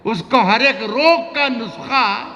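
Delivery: high, slow drawn-out verse; romantic shayari. Acted. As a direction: stretch the line out high. Poet recites ghazal.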